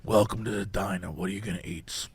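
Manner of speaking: soft, low diner waitress whisper; whispered asmr role play. Pretend. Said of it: "but it's like a diner".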